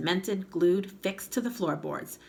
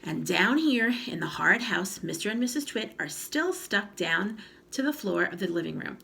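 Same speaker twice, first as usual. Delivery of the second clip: ordinary delivery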